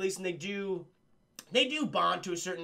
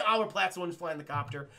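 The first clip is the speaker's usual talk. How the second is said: usual speaking voice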